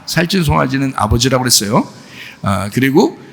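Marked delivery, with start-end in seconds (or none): none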